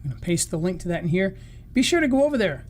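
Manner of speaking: ordinary talk, nothing marked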